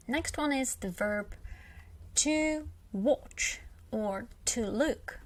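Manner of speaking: ordinary talk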